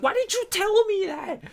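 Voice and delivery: very high, slow, squealed accusation; mock outrage. Translator scolding him for hiding his Japanese, high squeal. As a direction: wail it like she was tricked. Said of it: "she was like".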